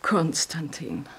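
ordinary delivery

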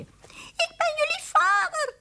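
slow, very high squeak; squeaky mouse. A narrator's reading. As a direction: cry out as a tiny mouse to your children. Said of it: "riep hij".

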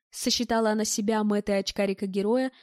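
usual speaking voice

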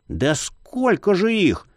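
slow, exasperated companion voice; Marius exasperated. Acted. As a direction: groan it out, fed up. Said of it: "выпалил Марий".

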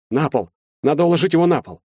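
alarmed command; urgent order. A narrator's reading. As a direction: take charge in an emergency. Urgent orders during heart attack.

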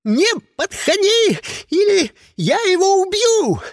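very high, loud, cracking yell; breaking-voice scream. A narrator's reading. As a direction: scream the threat, voice giving way. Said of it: "Заорал срывающимся голосом".

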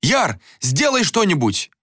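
high, urgent plea for help; panicked high-pitched appeal; straining, desperate cry. A narrator's reading. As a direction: implore frantically, pitch jumping up. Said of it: "надрывался Анатан".

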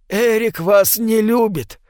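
put-on slow, high old man; a grim old man, warning. Old man warns Nora.

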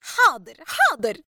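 two high, loud, falling huffs; resentful. A narrator's reading. Daughter leaving in grudging obedience, resentful. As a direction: give in with loud exasperation. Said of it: "وقالت بسخط وهي ترحل".

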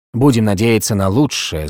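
ordinary delivery, nothing marked

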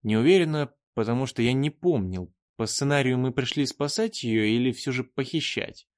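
plain reading voice

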